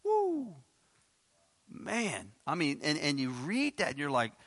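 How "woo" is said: a sudden high whoop of amazement. Real, not acted. Preacher whoops at the harsh words, high and sudden. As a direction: let out a high woo.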